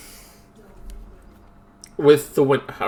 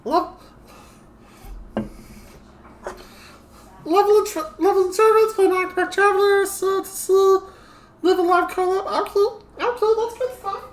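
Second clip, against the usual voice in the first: gremlin voice; 13 semitones higher than usual. Acted. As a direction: greet them as a cheeky gremlin. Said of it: "I'm currently in gremlin voice".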